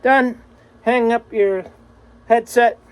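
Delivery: usual speaking voice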